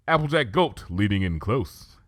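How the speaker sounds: plain reading voice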